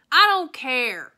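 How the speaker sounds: loud, slow holler. A narrator's reading. Opal hollering back to her father, loud. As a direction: yell it back stubbornly. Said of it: "I hollered".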